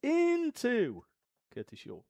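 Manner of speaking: booming quiz show host intro; Bullseye host impression. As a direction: announce like an eighties darts game show host. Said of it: "reminded me a little bit of Bullseye".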